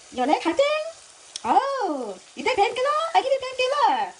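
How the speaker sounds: put-on high, loud Chinese-accented doctor; high-pitched mock Chinese accent; imitated Chinese accent. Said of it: "making her accent".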